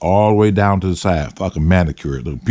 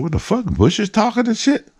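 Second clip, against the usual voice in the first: startled disbelief; 12 semitones higher, 3 dB quieter than usual. Retold or imitated. Startled by a talking bush.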